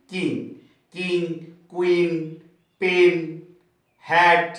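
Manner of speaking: one single beat per word, pauses between; each in one go. Shown as a demonstration. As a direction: fire off "কিং", "কুইন", "পেন", "হ্যাট" as separate single syllables. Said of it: "এই সব উচ্চারণ করছি আমরা". (প্রতিটি শব্দ এক ঝোঁকে উচ্চারণ)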